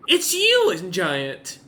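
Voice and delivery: excited giantess. Acted. Giant Sif speaks.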